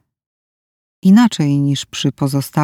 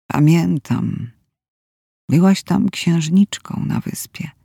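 weak rasping whisper of a dying man; hoarse and shaky. A narrator's reading. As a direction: barely get the words out, hoarse and trembling. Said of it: "Chrypiał i drżał". (chrapliwy, drżący szept)

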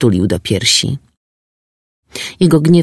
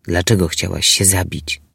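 gentle; 5 semitones lower, 5 dB quieter than usual. Acted. Gentle question to distressed girl.

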